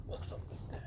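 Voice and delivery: breathy whispered repeat of the catchphrase; whispering. Pretend. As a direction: drop it to a whisper. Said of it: "whispered repeat".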